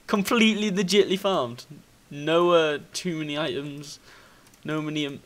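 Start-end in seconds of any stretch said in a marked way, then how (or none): none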